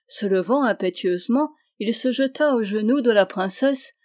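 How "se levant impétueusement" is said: ordinary delivery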